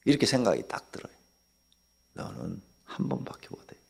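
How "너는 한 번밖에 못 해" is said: 1 whispered verdict. Retold or imitated. Pastor's inner verdict on councilman.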